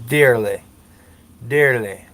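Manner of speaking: DEARLY drawn out in his own accent. Real, not acted. Showing listeners his accent on DEARLY, drawn out. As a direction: let the accent stretch DEARLY. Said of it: "See, that was a ... an accent".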